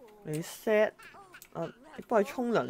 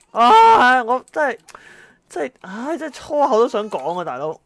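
much higher and louder fed-up groan; exasperated outburst; 7 semitones higher, 13 dB louder than usual. Real, not acted. Worn-out parent at 4 a.m. chaos, higher and loud. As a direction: blow up, about to swear.

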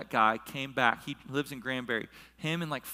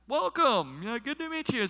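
high, eager host's welcome; eager greeting; 9 semitones higher than usual. Retold or imitated. Host to first party guests, eager greeting. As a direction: greet your first guests in a raised pitch.

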